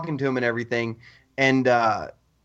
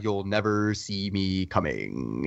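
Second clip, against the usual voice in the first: low, slow and much softer, a sinister promise; menacing villain voice; 9 semitones lower, 6 dB quieter, much slower than usual. Retold or imitated. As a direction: purr the threat like a movie villain. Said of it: "I even liked the way he was talking".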